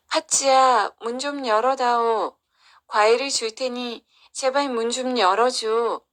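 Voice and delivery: calling out a plea through a door; imploring call to a sister; pleading. Acted. Kongjwi pleads at locked door.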